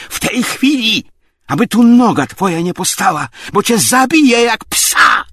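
very high, loud death threat; furious shout. A narrator's reading. Obłomow threatens Tarantiew to get out, furious shout. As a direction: explode with rage. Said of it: "blady i drżący od gniewu".

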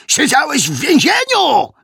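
very high, loud accusing shout; roared accusation. A narrator's reading. Westfield hammers the suspect about prison, roared accusation. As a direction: shout it at the top of your pitch.

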